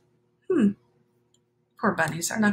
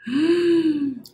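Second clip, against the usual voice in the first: sharp surprised gasp; 9 semitones higher than usual. Real, not acted. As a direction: catch your breath in surprise. Gasp on seeing bugs.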